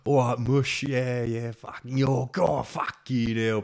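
boisterous hammed-up Cockney; Cockney impression. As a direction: put on your biggest Cockney geezer. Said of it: "put on my best Cockney impression".

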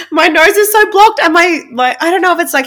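words bubbling with giggles; laughing. Real, not acted. Laughing at her blocked nose.

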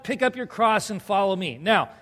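ordinary talk

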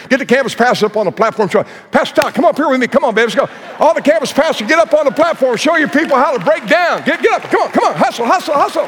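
very high, fast urging; excited urging. Real, not acted. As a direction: hurry them up with joy. Urging pastors up on stage.

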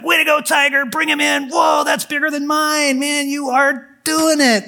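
high, loud excited cheering; proud father shouting with delight; dad cheering. Retold or imitated. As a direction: whoop it up like a proud dad at the lake. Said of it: "He's like".

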